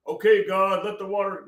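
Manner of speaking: loud, high mock command; Moses. Imagined Moses ordering God to move the water, mock commanding shout. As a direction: call it out like a hammed-up prophet. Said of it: "I don't think he was, like, going".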